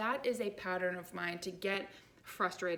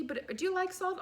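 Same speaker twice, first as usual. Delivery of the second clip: put-on chirpy old lady question; 10 semitones higher than usual. Elderly lady asks.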